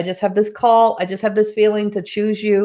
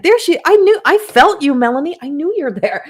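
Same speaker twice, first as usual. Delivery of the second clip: emotional outburst; 7 semitones higher, 6 dB louder than usual. Real, not acted. Overwhelmed, recalling Melanie's presence.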